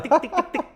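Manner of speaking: high ticking mimic; spider footsteps. Imitating a spider walking on the shed wall, high ticking. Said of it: "It's like".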